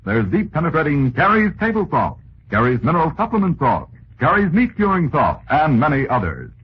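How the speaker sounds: ordinary delivery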